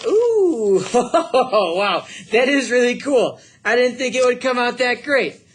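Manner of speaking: real delight at the big reveal; teacher unveiling the print to the class, thrilled; thrilled surprise. Real, not acted. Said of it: "I'm really happy with that".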